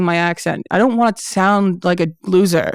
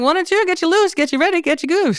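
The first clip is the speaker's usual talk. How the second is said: high, sing-song coaxing; 11 semitones higher than usual. Retold or imitated. Bookers urging the comic to drink, high sing-song coaxing. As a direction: egg the comic on to loosen up. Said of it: "They're like".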